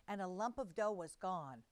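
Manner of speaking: plain reading voice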